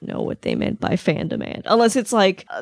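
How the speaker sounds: ordinary talk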